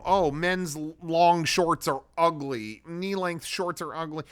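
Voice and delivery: a slow, whiny parody of the critics; mocking the critics. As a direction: sneer it like the people tweeting it. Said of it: "I keep seeing memes about".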